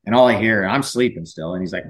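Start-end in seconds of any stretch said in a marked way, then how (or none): none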